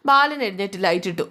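ordinary delivery

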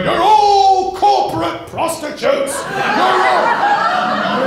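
high, loud, slow yelled heckle; heckler yelling. Retold or imitated. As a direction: scream it at the room like a furious heckler. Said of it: "stands up going".

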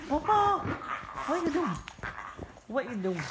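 very high-pitched talk to a dog; pet-talk voice. Real, not acted. Owner asking excited dog what's up, high pet talk. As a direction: coo at your dog in a high voice.

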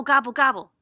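punchy, emphatic gobble sounds; loud gobbling. Acted. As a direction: gobble greedily in loud bursts. Donkey eating noise for children.

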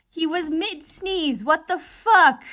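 loud, laughing outburst; excited shout. Real, not acted. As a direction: yell it in disbelief.

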